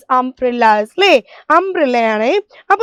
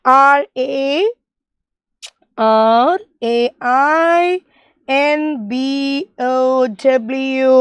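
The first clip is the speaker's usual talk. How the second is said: slow spelling; much slower than usual. Shown as a demonstration. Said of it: "how can we spell the word rainbow".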